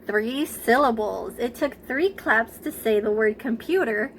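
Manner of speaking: ordinary talk, nothing marked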